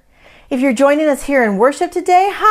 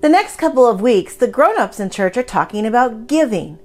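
earnest upbeat school lecture; 4 semitones higher, 4 dB louder than usual. Acted. Children's time greeting to kids.

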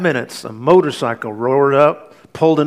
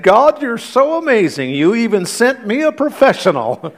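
mock sobbing thanks, pitched high and louder; tearful thanks; 9 semitones higher, 3 dB louder than usual. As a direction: gush thanks to God, high and loud, a touch tearful. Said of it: "through sobbing tears".

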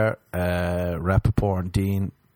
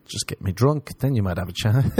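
usual speaking voice

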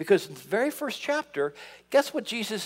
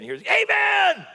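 much higher, slow, loud hammed-up amen; mock shouted amen; 13 semitones higher, 5 dB louder, slower than usual. Preacher imitating a lone member shouting amen, slow high mock shout. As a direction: belt it like a lone enthusiast. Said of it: "You're just like".

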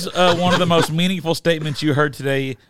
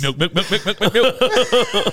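laughing imitation of a confident answer, rising higher; mock confident; 7 semitones higher, 4 dB louder than usual. Host teases friend over the wrong answer, laughing mock confidence. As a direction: parrot it back through laughter, louder at the end. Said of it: "He was so confident".

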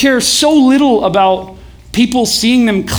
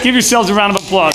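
ordinary talk, nothing marked

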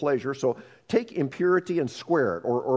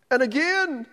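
rising mock lament; 12 semitones higher than usual. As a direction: push the second and again higher. Lament climbs higher.

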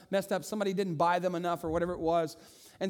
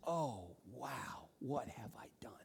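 hushed, much softer, lower whisper; horrified whisper; 7 semitones lower, 13 dB quieter, slower than usual. Acted. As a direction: breathe it out in dawning shock. Son realizes his sin.

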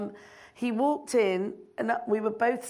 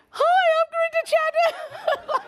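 much higher, louder, laughing shriek of a greeting; overexcited shout; 6 dB louder than usual. Retold or imitated. Director introducing herself to [name], overexcited shriek. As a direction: blurt your name at your hero. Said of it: "Like, I was like that. It was really not cool".

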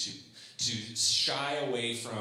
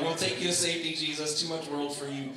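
mock rescue; 5 semitones higher, 4 dB louder than usual.

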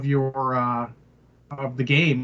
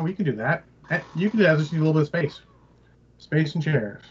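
ordinary delivery

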